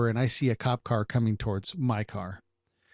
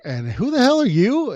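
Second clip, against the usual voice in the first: indignant challenge; 11 semitones higher, 6 dB louder than usual. Retold or imitated. Confronting a sassy neighbor.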